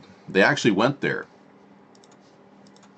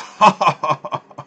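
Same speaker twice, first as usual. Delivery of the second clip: villain laugh; 6 semitones higher, 5 dB louder than usual. Pretend. Laugh after the mock Russian line.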